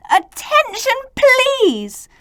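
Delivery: high, pleading call for order; stammering plea. A narrator's reading. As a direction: plead for calm, voice way up. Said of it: "A stammered".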